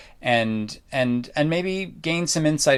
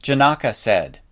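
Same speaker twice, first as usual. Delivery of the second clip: normal talking voice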